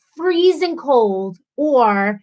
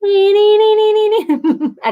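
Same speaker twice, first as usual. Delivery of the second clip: laughing; 10 semitones higher, 6 dB louder than usual. Real, not acted. Laughs at her own confusion.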